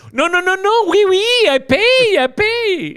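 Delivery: high, loud, hammed-up French tourist; mock French. Mocking themselves paying up at the airport, high hammed-up French. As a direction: squeal it like a flustered Frenchman paying. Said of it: "that's gonna be us at the airport".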